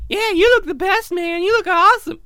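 high, loud squeaky flattery; dolphin voice. Acted. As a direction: gush like an eager dolphin. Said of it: "you walk up to the dolphins".

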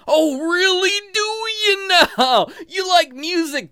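loud, high, drawn-out sarcasm; mock amazement. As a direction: gush in mock wonder at the obvious. Mocking reply to profile writer.

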